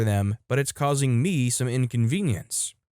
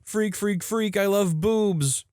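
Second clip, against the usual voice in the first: mock scream; 8 semitones higher, slower than usual. Teenager going down the water slide, mock scream. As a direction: imitate a teen's scream without real volume. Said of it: "he screamed".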